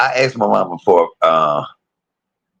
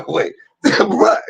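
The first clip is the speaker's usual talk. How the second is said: laughing; 12 semitones higher than usual. Real, not acted. Laughing through words.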